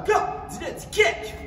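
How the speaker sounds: high, loud karate yell on the kick; kiai shout. Shown as a demonstration. Karate teacher shows the class the kick shout, loud. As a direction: bark the kick call out like a martial arts shout. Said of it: "c'est à toi de le faire".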